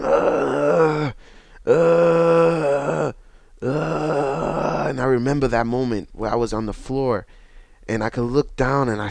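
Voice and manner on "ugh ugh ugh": loud high groans of pain, breaking toward a cry; reliving the pain with loud, high, crying groans. Retold or imitated. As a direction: groan out in agony after the fall. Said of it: "I was screaming".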